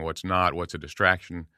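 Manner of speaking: ordinary delivery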